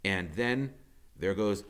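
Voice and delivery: usual speaking voice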